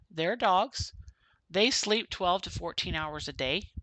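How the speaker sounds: ordinary talk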